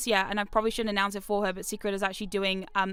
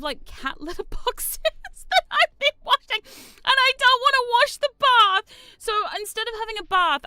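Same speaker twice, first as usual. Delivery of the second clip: much higher, louder whine through laughter; laughing whine; 13 semitones higher, 9 dB louder than usual. Real, not acted. Streamer complaining about cat litter in the bath, laughing whine. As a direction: squeal it out, laughing at the mess.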